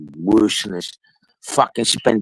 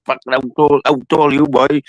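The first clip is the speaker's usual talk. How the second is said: loud, barked order, pitched up; imitating a shouting cop; 6 semitones higher, 6 dB louder than usual. Retelling the giant copper at the door, mock shouting. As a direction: bellow it like a huge copper ordering him out.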